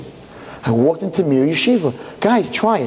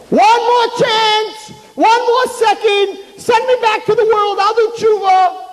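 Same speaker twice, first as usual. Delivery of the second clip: much higher, louder, drawn-out wailing plea; desperate screaming; 4 dB louder, slower than usual. Retold or imitated. Souls in Gehenna scream to be sent back. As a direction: beg for one more chance in agony. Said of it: "That's what they scream".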